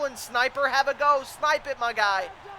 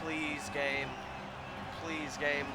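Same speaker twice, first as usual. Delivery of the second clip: worn-out, quieter voice; strained voice; 8 semitones lower, 9 dB quieter than usual. Real, not acted. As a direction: speak with a failing voice. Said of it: "My voice is going".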